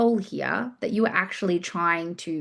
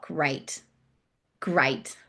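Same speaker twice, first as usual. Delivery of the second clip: normal talking voice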